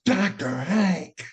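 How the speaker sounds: high, drawn-out mock call. Man from the store hails him at the limo, calling out. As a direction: imitate an astonished man hailing someone, slowly. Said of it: "I hear this voice".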